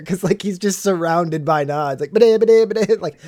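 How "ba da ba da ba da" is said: loud, high stuttered babble; mock cartoon stammer; Porky the Pig. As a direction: sputter nonsense syllables under pressure. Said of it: "like Porky the Pig over there".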